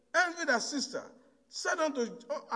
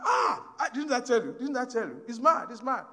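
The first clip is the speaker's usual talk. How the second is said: triumphant cry; 3 semitones higher, 5 dB louder than usual. Retold or imitated. Coffin owner gloats that the reader went mad, loud triumphant cry. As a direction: crow that you were right all along.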